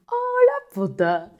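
very high, loud, slow shriek; shrieked insult. Retold or imitated. Retelling her reaction to the rude lady, shrieked. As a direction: shriek it in disbelief. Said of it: "eu fiquei tipo".

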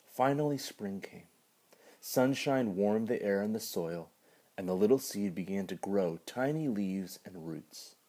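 gentle storybook reading; story narration. Acted. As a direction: read it like a bedtime story. Reads children's story aloud.

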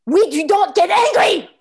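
put-on high, loud French-accented snap; angry outburst. Frenchwoman insists on composure, exploding in anger. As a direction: burst out angrily, betraying the words.

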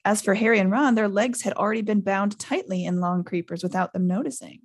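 ordinary delivery

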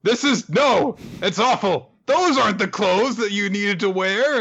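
high-pitched, hammed-up outrage; appalled kidnapper. Kidnapper to the wrongly dressed girl, mock appalled. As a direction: splutter in mock dismay. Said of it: "And he's like".

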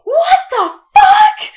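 hammed-up shriek, very high, slow and loud; a huge laughing yell from the sidelines; melodramatic shout. Sister yelling from the sidelines at the photo, hammed-up shriek. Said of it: "I'm being a little melodramatic".

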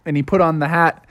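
usual speaking voice

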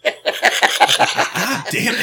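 laughing high through the words. Real, not acted. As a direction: squeal it out laughing. Joking about pirated streams.

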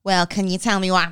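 loud, abrupt, exaggerated nagging; mock your own accent as a grating bark; hammed-up grating customer-service voice. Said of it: "it sounds like I'm going".

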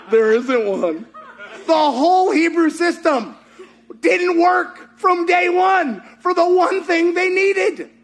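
high, stretched-out incredulous laughter; amused dad laughing. Retold or imitated. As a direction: imitate dad cracking up over the absurdity. Acts out dad laughing at hilarity.

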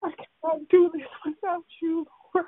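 real tears, voice cracking as she pleads; crying prayer. Real, not acted. Woman pleading with God on the prayer call, sobbing.

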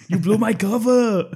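high, loud heckle; mock shout. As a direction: shout it from the back, hammed-up. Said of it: "somebody shouts from the back".

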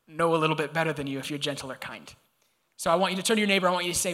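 usual speaking voice

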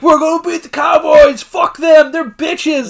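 loud, high rowdy locker-room shouting; going off. Retold or imitated. Rival team banging through the vent, going-off shouts. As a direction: hype it up, shouting trash talk. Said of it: "Like, going off".

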